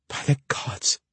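breathless whispered gasp. A narrator's reading. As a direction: gasp it out in shock. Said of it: "she gasped".

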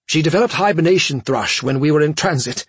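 high urgent pleading; panicked. Acted. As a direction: rush it out in panic.

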